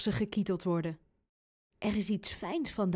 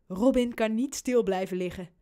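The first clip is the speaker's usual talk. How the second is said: ordinary delivery, nothing marked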